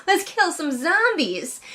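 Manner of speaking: excited, laughing cheer; mock bloodthirsty glee; gleeful. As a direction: cheer like a kid at the fun part. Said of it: "I'd be like".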